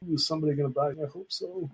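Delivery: high, fading little worried voice; mock anxious hoping. Artist at the booth, mock fretting over a sale. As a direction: play the nervous seller, half joking. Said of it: "I'm not just sitting there like".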